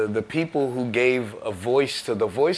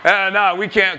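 dismissive label man; 6 semitones higher, 6 dB louder than usual. Retold or imitated. Imitating record label rejection.

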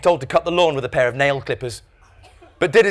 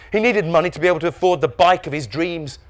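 plain reading voice

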